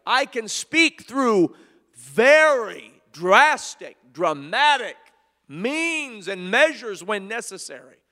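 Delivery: loud, slow, dramatic divine voice; God declaring his power, loud and slow; God speaking. Acted. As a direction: boom the word drastic. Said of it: "So he was showing Elijah".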